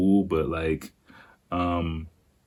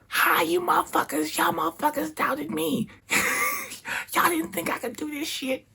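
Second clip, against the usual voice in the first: very high, strained squawk at normal volume; hammed-up, squealing victory taunt; triumphant mock yell; 4 dB quieter than usual. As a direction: burst in crowing at the doubters. Acts out triumphant entrance into bar.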